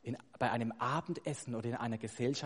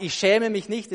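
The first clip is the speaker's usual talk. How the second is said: "Ich schäme mich nicht" high and loud; emphatic negation; 6 semitones higher, 10 dB louder than usual. Acted. Preacher quoting Paul's rhetorical negation, emphatic. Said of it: "die Betonung der Verneinung".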